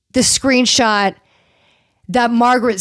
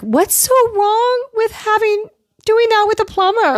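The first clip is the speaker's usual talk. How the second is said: much higher, put-on indignant cast member; mock indignant voice; 11 semitones higher than usual. Cast member defending the plumber line, in high mock voice. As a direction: squeak it in fake innocence.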